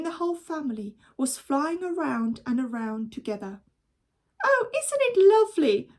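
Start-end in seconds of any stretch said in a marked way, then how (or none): 4.4-5.9 high, loud, thrilled exclaim; delighted cry. Acted. Boy thrilled while flying, high delighted cry. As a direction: marvel out loud at the flight. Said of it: "cried William".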